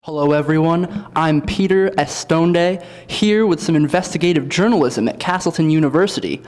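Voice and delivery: upbeat TV field reporter voice; cheery local news anchor delivery. Acted.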